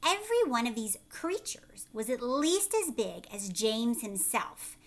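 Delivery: usual speaking voice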